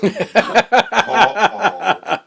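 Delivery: high laugh through the words; laughing high at his own mistake. Real, not acted. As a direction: laugh as it sinks in. Laughing at the mixup.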